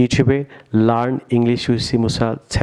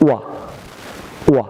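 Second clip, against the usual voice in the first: normal talking voice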